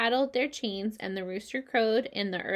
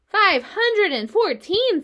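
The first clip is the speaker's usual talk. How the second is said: high, loud and slow, amazed; astonished exclaim; 10 semitones higher, 7 dB louder, slower than usual. A narrator's reading. Pig marvels at the spider's egg count, astonished. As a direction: gasp the number out in wonder.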